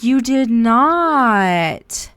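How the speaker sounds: slow, high, loud and stunned; gasping friend who can't believe the news; shocked disbelief. Retold or imitated. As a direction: drag out the name in total shock. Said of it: "She's like".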